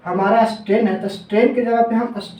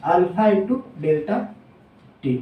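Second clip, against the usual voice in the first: formula spelled out slowly, word by word; slow dictation; 3 semitones lower, much slower than usual. Shown as a demonstration. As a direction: dictate it slowly so students can write. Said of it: "strain equal to हमारा क्या हो गया?". (धीरे-धीरे लिखवाते हुए)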